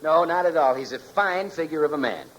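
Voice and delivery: ordinary delivery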